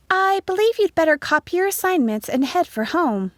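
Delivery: high, lilting schoolteacher voice; high-pitched classroom announcement; teacher instructing. A narrator's reading.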